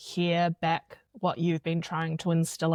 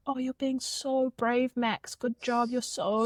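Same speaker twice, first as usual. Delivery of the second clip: put-on higher, encouraging small-boy voice; little boy praising; 6 semitones higher than usual. Freddie praising Max in the car, higher child voice. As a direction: play the big brother cheering on Max. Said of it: "Freddie will go".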